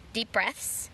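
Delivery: long slow breath out, very soft; audible exhale to calm down; deep calming sigh. Shown as a demonstration. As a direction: breathe out the frustration. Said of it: "I have to just".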